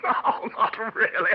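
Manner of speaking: high laugh into the words; amused incredulous laugh; laughing disbelief. Acted. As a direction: chuckle through oh no not really.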